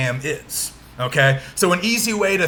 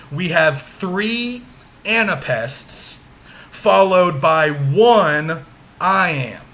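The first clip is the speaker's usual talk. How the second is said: slow, spaced out for note-taking; slow dictation; slower than usual. Real, not acted. Teacher giving students the answer to write down, slow dictation. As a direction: dictate it slowly, leaning on the key words.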